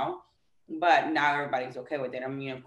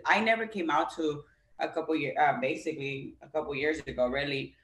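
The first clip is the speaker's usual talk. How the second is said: ordinary talk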